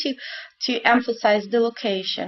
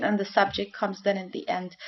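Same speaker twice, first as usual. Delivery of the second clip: ordinary delivery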